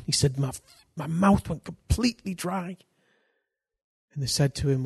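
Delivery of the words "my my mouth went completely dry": high-pitched imitation of a posh Englishman; mock posh English voice. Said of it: "He's a posh man".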